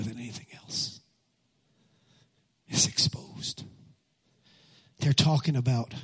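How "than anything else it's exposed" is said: hushed, breathy whisper for emphasis. Real, not acted. Pastor whispers to the congregation, hushed. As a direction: drop to a whisper to land the point. Pastor stressing covid's revelation.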